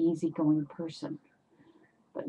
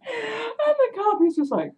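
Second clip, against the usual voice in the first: laughing; 14 semitones higher than usual. Real, not acted. Still laughing as she tells.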